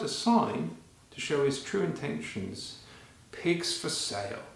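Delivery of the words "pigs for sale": ordinary delivery, nothing marked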